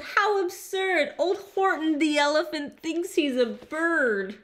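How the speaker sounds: high-pitched teasing jeer; scoffing crowd voice, pitched high; taunting yell. A narrator's reading. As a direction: yell it mockingly at the elephant. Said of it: "they taunted, they teased him, they yelled".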